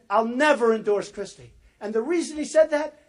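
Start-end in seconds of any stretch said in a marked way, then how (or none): 0.1-1.5 loud, emphatic retelling of his vow; declared loudly in a retold quote. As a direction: punch it out like quoting a firm promise.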